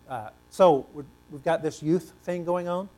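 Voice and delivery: ordinary talk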